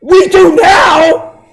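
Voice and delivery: high, slow, loud triumphant cry; Schrodinger's hammed-up victorious shout; punchline shout. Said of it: "And Schrodinger says".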